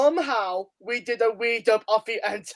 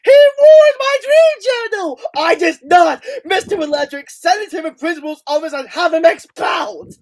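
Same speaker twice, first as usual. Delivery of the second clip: high, loud, shouted tantrum; hammed-up furious accusation at full volume; quoted movie outburst; 7 semitones higher, 9 dB louder than usual. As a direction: yell it like an outraged movie kid. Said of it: "I'm gonna quote it".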